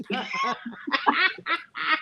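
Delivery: long high giggle; high helpless laugh. Real, not acted. Laughing at a silly clip with a friend, high giggle.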